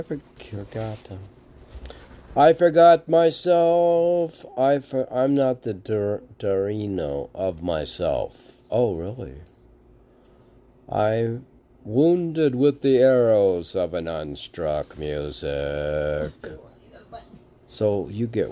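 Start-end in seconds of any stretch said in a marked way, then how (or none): 2.3-4.4 poem line. Acted. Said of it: "reciting poem line". 10.9-16.3 poem line. Acted. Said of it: "reciting poem line".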